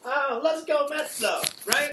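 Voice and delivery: put-on high, loud drunk Mister Met; loud drunk heckling. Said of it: "drunk guy at the bar".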